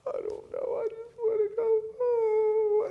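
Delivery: very high, slow tearful whine; crying child. Retold or imitated. A daughter crying that she wants to go home. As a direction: wail it like a sobbing little girl.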